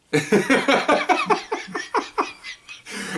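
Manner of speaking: long high disbelieving laugh; high incredulous laugh. Real, not acted. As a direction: laugh at the absurdity. Laugh alone after silence.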